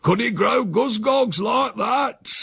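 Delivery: high, drawn-out rustic crowing; boastful old uncle. A narrator's reading. Uncle boasts to his nephew about gooseberries, boisterous. Voicing Uncle Silas in story.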